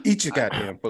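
high, stern mother voice; scolding mom. Retold or imitated. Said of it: "Like my mom used to me".

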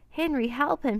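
high, distressed storybook voice; lightly acted tearful plea; mild sobbed plea. A narrator's reading. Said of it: "she sobbed".

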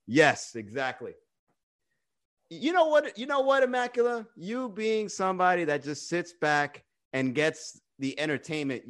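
ordinary delivery

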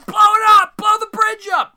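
very high, very loud desperate scream; screaming order. Retold or imitated. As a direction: Shears yelling across the river. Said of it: "Shears starts screaming".

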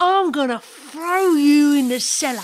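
angry threat. Acted. Woman threatening punishment.

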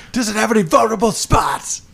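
high, soft comic voice; mock commander question. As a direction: ask it like a nervous hero.

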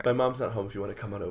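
very fast mumbled line. Pretend. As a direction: rattle it off very fast. Fake recorded message.

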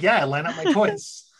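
put-on very high, innocent child voice; naive kid. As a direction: imitate a kid proudly chiming in. Plays naive self as kid.

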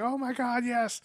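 high-pitched eager yes; excited crowd. Retold or imitated. Audience answering the concert offer, high excited cheer. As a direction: imitate a thrilled crowd, pitched well up.

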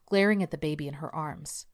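ordinary delivery

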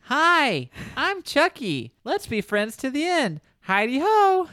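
high, loud toy doll chirp; sing-song talking toy doll. Retold or imitated. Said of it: "And the doll goes".

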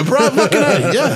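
high, loud burst with a laugh; excited agreement. Real, not acted. Agreeing with friends about the creep, laughing burst.

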